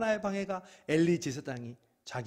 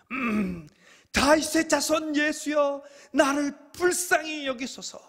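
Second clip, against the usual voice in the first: much higher, louder, slow pleading shout; desperate cry; 11 dB louder, slower than usual. Acted. Blind Bartimaeus crying out to Jesus, slow loud plea. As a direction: cry out for mercy with everything.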